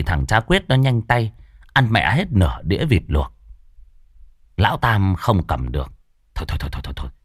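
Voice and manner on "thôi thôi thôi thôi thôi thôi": hushed. Acted. Stopping the eating.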